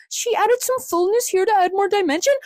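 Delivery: high-pitched fake presenter voice; mock influencer. Influencer explaining face fillers, high mocking voice. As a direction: parody a beauty explainer. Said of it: "She's like".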